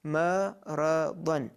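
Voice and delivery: "maradan" drawn out slowly, each syllable apart. Shown as a demonstration. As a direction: call out "maradan" slowly, one syllable at a time. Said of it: "Call out phase".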